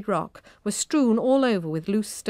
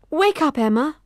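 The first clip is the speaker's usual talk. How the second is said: anxious; 8 semitones higher, 4 dB louder than usual. A narrator's reading. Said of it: "she said anxiously".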